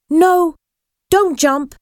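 high, urgent plea; urgent warning. A narrator's reading. Ghost girl stops boy at the ship's side, urgent warning. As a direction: call out high to stop someone.

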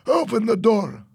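high, loud command; acted urgent shout; shouted order. A narrator's reading. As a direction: call it out to the pilot. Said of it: "Fernando shouted to Tony".

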